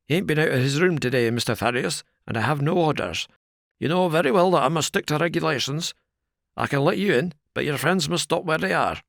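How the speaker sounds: a wary doorman sticking to his orders; the porter McMurdo questioning Thaddeus at the door, rough and wary; gruff porter. A narrator's reading. Porter refuses entry.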